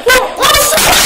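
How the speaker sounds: loud surprised yell, then laughing; excited shout into laughter. Real, not acted. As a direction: erupt with joy at the kill. Surprise double kill in game.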